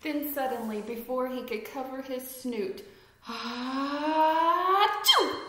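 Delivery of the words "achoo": loud, high hammed-up sneeze; big sneeze. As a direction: let the sneeze explode. Flea sneezes in story.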